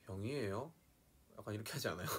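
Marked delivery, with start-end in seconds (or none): 0.0-0.7 South Gyeongsang question rising at the end; South Gyeongsang accent. Shown as a demonstration. Contrasting northern and southern regions, South Gyeongsang accent. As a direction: ask with a South Gyeongsang lilt, rising last. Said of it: "경상남도 쪽은".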